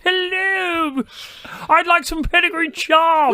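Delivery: high, slow hammed-up toff; mock posh voice. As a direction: play a snooty aristocrat, slowly. Mock posh dog owner voice.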